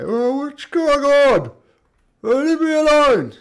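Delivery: hammed-up monster begging to be left be; distressed giant. Blind giant wailing at his attackers, loud and confused. Giant wails after being hit.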